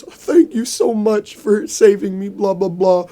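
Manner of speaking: high, slow put-on voice of a grateful dad. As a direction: gush thanks in a high, drawn-out voice.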